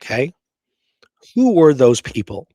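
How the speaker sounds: usual speaking voice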